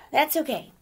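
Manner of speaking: plucky self-encouragement; determined exclaim. Acted. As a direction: push through it, I can do this. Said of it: "she exclaimed".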